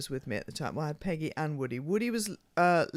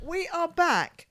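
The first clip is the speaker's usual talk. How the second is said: much higher, drawn out and slow; grand announcement; 11 semitones higher, slower than usual. Acted. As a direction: announce it like a show opener. Second show opener.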